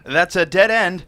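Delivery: loud call down the dock. Acted. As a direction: shout a warning across the water. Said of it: "calling out".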